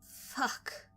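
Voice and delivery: breathy frustrated curse under the breath; swore. A narrator's reading. Vanessa as Daniel drives off, muttered curse. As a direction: spit it out half-voiced. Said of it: "I swore".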